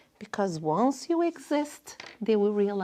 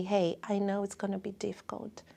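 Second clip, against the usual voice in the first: tender reassurance; 8 dB quieter than usual. Shown as a demonstration.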